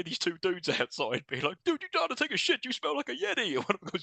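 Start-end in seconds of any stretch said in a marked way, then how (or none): 1.6-3.5 high-pitched jeering dude voice; mock dude heckle, pitched up; heckling dude. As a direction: taunt them like a bro outside the door. Dudes heckling outside, acted out.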